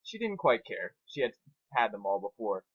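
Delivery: usual speaking voice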